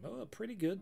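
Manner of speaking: normal talking voice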